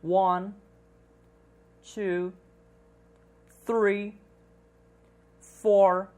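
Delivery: "one", "two", "three" each said alone in careful English, with a long pause after each; English number demo. Shown as a demonstration. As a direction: model teacher reading "twenty-one", "thirty", "one hundred" slowly and clearly. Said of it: "Apenas ouça a pronúncia correta de cada um dos números".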